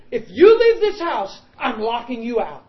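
loud, high threatening husband; hammed-up furious husband yelling; mock angry shout. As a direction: bellow the threat at her. Husband threatening wife, retold.

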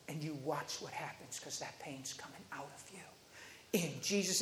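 low, soft, sure command; quietly confident prayer, retold; bold and confident. Preacher praying pain out of a girl, bold and confident. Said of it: "I said this real bold and confident".

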